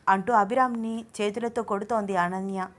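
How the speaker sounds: usual speaking voice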